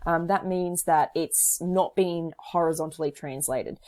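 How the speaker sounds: ordinary talk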